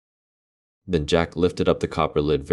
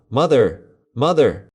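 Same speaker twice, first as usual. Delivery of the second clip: loud, higher urgent call for mother; 9 semitones higher, 6 dB louder than usual. Acted. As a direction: yell to mother from the beanstalk. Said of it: "he called out".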